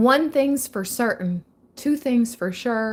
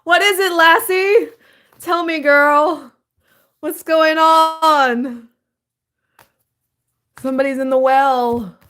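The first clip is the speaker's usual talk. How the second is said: mock dog owner; 8 semitones higher, 7 dB louder than usual. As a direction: coax the dog to tell you, hammed-up. Keeps Lassie dog-owner bit.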